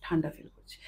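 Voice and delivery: hushed, as if deep in trance; slow quiet voice. Retold or imitated. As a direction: client in trance murmurs she feels cold, whispering. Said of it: "আমি আস্তে আস্তে বলছি".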